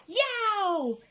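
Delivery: high, sharp cat yowl; Scarface Claw yowl. A narrator's reading. Tough tomcat scaring off the dogs, high yowl. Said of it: "said Scarf-, Scarface Claw".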